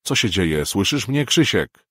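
desperate calling. A narrator's reading. Calling into dead phone.

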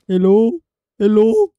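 high nervous call into the dark; loud wary hello. Real, not acted. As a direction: call out, hoping nobody answers. Calling out nervously.

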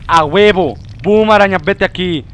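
loud, high victory yell at the spider; loud cheering shout; triumphant shout. Real, not acted. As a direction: crow over the spider in triumph.

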